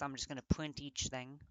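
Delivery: normal talking voice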